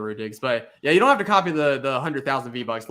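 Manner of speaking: normal talking voice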